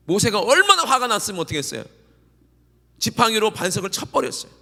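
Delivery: loud, rising revival preaching; fervent preaching. Acted. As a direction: drive the congregation to response. Preaching at full force. (힘차게 외치는 부흥 설교)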